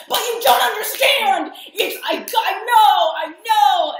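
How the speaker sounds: high, loud pleading; mock desperate insisting. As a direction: insist you just know it. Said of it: "And you're like".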